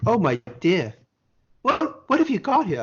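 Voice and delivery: high-pitched, mock-dismayed official; alarmed customs woman. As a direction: imitate a woman taken aback, pitched well up. Retells alarmed customs woman's reaction.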